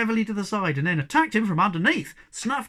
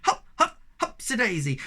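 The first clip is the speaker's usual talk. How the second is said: shouted; 5 semitones higher than usual. Acted. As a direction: yell it while bouncing. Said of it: "they shouted".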